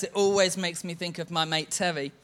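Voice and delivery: ordinary talk, nothing marked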